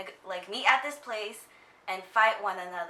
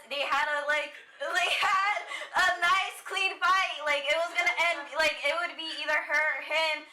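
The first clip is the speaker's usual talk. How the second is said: high-pitched, laughing through upset; half-laughing, half-crying about it; laughing outrage; 7 semitones higher than usual. Real, not acted. Furious recap of betrayal scene.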